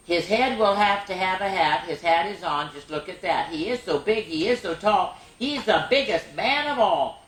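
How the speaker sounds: sing-song rhyming read-aloud; bouncy picture-book reading; reading to children. Acted. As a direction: lilt each line for little listeners. Reads rhyming snowman book aloud.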